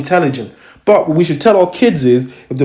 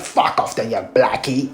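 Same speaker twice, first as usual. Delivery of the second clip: lower, softer Cockney officer imitation; mock Cockney; 5 semitones lower, 7 dB quieter than usual. Imitating officer sending him away, low mock Cockney. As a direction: do a dismissive Cockney copper, low and under your breath. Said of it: "with his little fucking Cockney accent".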